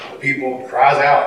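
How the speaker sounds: ordinary talk, nothing marked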